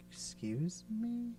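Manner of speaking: quietly incredulous "excuse me"; taken-aback retort. Retold or imitated. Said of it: "And I'm like".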